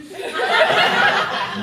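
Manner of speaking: breathy high burst of laughter. Real, not acted. Laughing as she retells the mispronunciation. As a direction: laugh at the wrong name.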